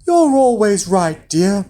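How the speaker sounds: placating wife; giant's wife. A narrator's reading. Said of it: "said his wife".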